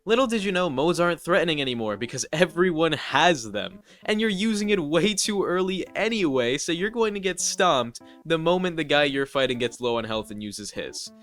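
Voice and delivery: usual speaking voice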